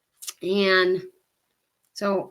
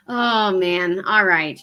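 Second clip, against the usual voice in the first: normal talking voice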